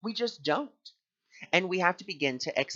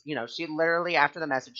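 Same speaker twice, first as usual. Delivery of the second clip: ordinary delivery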